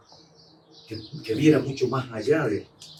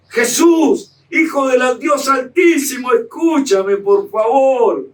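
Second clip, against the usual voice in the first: much higher, loud, slow pleading shout; desperate shouted prayer; 11 semitones higher, 7 dB louder, much slower than usual. Shown as a demonstration. Preacher showing the congregation how to call Jesus, shouting slowly. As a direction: cry out to Jesus at full voice, begging. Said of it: "Así llamémoslo".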